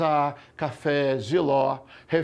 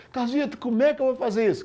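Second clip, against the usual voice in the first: higher-pitched student's question; puzzled student; 6 semitones higher, faster than usual. Acted. Student asks Carlito how to do it, puzzled. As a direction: imitate a student asking, pitched up. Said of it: "Aí você fala".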